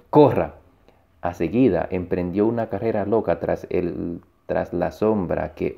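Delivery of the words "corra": loud urgent cry; sudden loud yell; shout. A narrator's reading. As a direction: shout it out as an order. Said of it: "y gritó".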